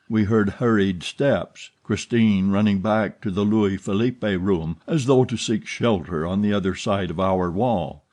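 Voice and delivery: ordinary delivery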